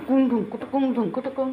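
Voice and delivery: soft, quiet cooing at the pet; playful cooing call. Acted. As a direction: coo it gently to coax the pet. (পোষ্যকে আদুরে সুরে ডাকা)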